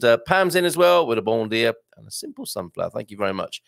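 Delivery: ordinary talk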